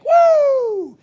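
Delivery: mock a silly cheer; sarcastic squeal of delight. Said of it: "your initial reaction is just like".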